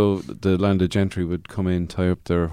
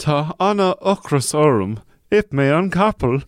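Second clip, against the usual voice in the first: lightly put-on fake Irish, pitched up; mock pidgin Irish; 5 semitones higher than usual. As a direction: babble like a joke Irish speaker, a little higher. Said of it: "there's my pidgin Irish".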